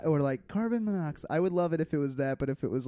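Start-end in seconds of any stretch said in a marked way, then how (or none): 0.5-1.3 higher-pitched automated alarm lady; computer voice lady. Acted. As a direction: imitate a computer alarm announcement. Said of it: "that kinda computer voice lady".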